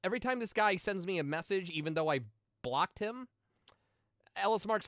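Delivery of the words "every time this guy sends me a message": usual speaking voice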